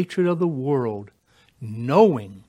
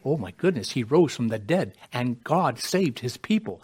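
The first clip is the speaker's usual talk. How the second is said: ordinary talk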